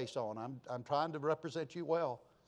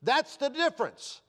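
raised, ringing exhortation; fervent preaching; 7 semitones higher, 6 dB louder than usual. Real, not acted. Preacher urging the congregation to set their hearts above, fervently. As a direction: drive each repeated phrase home, higher and louder.

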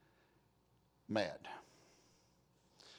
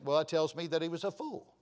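usual speaking voice

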